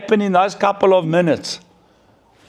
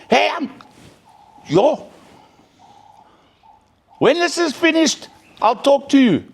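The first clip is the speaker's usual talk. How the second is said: high mock mum brushing the child off; imitated annoyed mother; 10 semitones higher than usual. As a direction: shoo the kid away, irritated and mocking. Mother dismissing her child.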